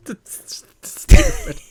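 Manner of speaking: very high, slow, loud squeal; mock squeal. Laughing co-host, squealing high in mock disgust. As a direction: squeak out the complaint in falsetto.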